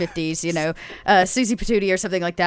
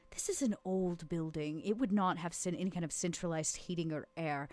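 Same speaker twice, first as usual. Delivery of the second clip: hushed Vera; 12 dB quieter than usual. Acted. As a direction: keep it down, almost private. Player speaks as Vera quietly.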